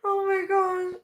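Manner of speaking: high, weak gasp of amusement; can't stop laughing. Real, not acted. Streamer recovering from a laughing fit, high and helpless. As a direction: sigh it out high, spent from laughing. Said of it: "I actually can't stop laughing".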